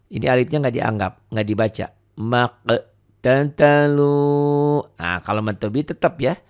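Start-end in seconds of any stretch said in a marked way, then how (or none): 2.2-4.9 slow tajwid chant; Quran recitation. Shown as a demonstration. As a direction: draw the words out like a reciter. Recitation example. (lantunan tartil pelan)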